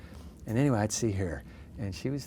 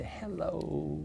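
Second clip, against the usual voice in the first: put-on Southern accent; 7 semitones higher, 6 dB quieter than usual. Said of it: "you know, in a Southern accent".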